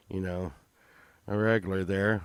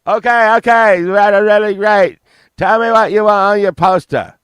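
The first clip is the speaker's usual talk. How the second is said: much higher, much louder frantic rock-star yell; 11 semitones higher, 16 dB louder than usual. Retold or imitated. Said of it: "[name] was like".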